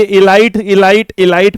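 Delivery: इलाइट said "i-LAIT", again and again; mispronounced "elite". Retold or imitated. Teacher imitating students' misreading, इलाइट rhyming with "light". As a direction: repeat इलाइट ending like "light". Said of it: "इलाइट नहीं".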